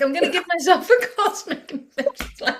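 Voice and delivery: a laughing high-pitched cosmic slap line; high laughing self-talk. Retold or imitated. Woman mocking her own self-talk, laughing, high and loud. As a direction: squeal it high through a laugh. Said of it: "I'm like".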